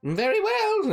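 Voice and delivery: high sweet gnome shopkeeper; gnome woman voice. Acted. Gnome woman serves Blossom, high sweet voice. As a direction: coo it like a kindly gnome granny.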